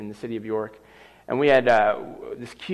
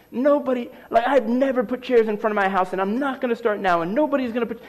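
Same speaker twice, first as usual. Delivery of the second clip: mock yell, much higher, a touch of fury; retold angry yelling; 9 semitones higher than usual. Neighbor ranting about chairs, mimicked high and heated. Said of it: "just starts yelling, cursing".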